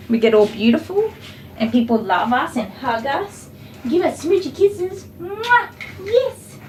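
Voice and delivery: lightly voiced delighted dog, rising into high squeals; happy dog's voice. Groomer speaking as the dog who loves being kissed, happy dog voice.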